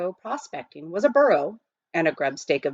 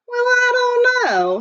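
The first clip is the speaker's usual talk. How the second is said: put-on much higher, slow, drawn-out old miner voice; smiling old miner; 6 dB louder, slower than usual. A narrator's reading. Old miner doubting the nugget's worth, smiling and slow. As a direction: tease the boy gently, stretched out high. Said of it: "smiled Pitch Pine Billy".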